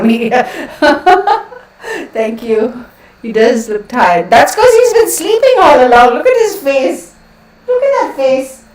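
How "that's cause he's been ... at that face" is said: much higher, louder pet voice. Real, not acted. Owner gushing over her tired dog, high baby talk. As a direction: gush over the dog's face.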